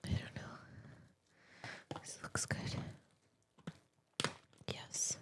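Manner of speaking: drawn-out unvoiced whisper. Real, not acted. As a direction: whisper slowly and softly. Whispering while eating.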